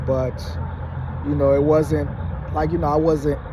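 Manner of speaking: ordinary talk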